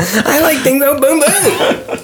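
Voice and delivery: put-on high laughing baby talk; big baby voice. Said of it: "He talked like a big baby".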